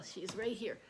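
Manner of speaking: ordinary talk, nothing marked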